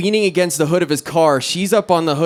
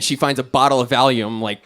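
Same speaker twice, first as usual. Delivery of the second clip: ordinary talk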